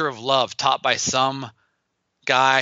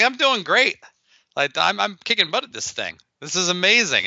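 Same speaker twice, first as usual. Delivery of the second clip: much higher, breezy overconfident protest; mock-cheerful insistence pitched up high; cocky brush-off; 7 semitones higher than usual. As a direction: wave off the warning, all bravado. Cocky reply to teacher's warning.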